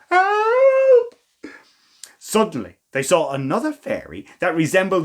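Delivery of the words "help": high, loud scream of help. Acted. Charlie trapped at the top, screaming high for help. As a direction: shriek for rescue from the tower top. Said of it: "was screaming for help".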